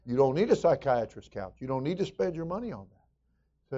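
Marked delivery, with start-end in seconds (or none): none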